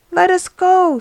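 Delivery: healed man urging his friend on; eager cry. Acted. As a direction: burst out, ready to run.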